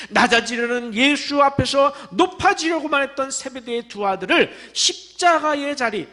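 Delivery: normal talking voice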